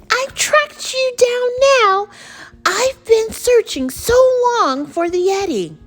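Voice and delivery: high, loud triumphant exclamation; excited shouting. Acted. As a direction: cry it out high, thrilled at the find. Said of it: "shouted George".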